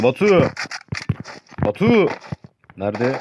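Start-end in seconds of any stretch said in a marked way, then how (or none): 0.0-2.1 rising high call of a name. Real, not acted. Gamer calling a teammate in voice chat, high and rising. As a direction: call him across the map.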